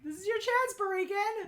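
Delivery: high, loud egging on; rally him to answer; excited urging. Real, not acted. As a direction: cheer a viewer on to win. Urging a viewer in chat.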